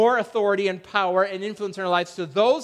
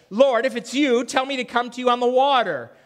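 Peter pleading; 6 semitones higher, 6 dB louder than usual. Retold or imitated. Peter's plea voiced in sermon.

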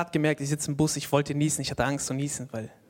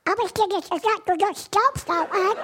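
Donald Duck voice; 14 semitones higher than usual. Retold or imitated. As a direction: squawk it like an angry cartoon duck. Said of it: "mit so einer Donald Duck Stimme".

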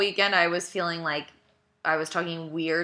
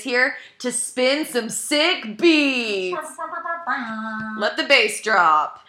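DJ hype voice; 4 semitones higher, 3 dB louder, much slower than usual. Pretend. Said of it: "That's exactly what a D J sounds like".

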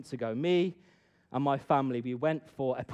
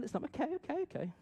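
softer, fed-up inner voice; a hushed, irritated private thought; inner grumble; 10 dB quieter, faster than usual. Retold or imitated. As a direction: mutter it to yourself, annoyed. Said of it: "So I'm thinking".

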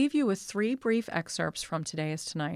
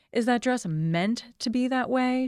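higher, sweetly cooing question; 6 semitones higher, 4 dB louder than usual. A narrator's reading. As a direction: coo it with false sweetness. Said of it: "she cooed sweetly".

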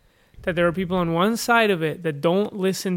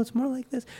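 soft, higher mid-Wales lilt; a quiet hint of mid-Welsh accent, pitched up; 7 semitones higher, 9 dB quieter than usual. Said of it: "if you're from the middle".

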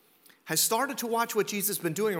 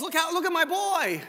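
proud mother; 7 semitones higher, 3 dB louder than usual. Acted. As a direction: show off your son, pitched high. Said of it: "his mother was so proud of her boy".

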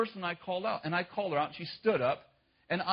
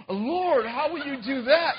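high, loud worried question; alarmed plea; 9 semitones higher, 5 dB louder, slower than usual. Retold or imitated. Preacher begging God to explain, alarmed. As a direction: plead in alarm.

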